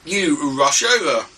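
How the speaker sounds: ordinary talk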